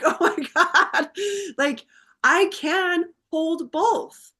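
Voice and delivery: high, laughing through tears; laughing and sobbing. Retold or imitated. Daughter in the hospital, laughing and sobbing with relief. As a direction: laugh it out, half crying with relief. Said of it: "I just start laughing and sobbing".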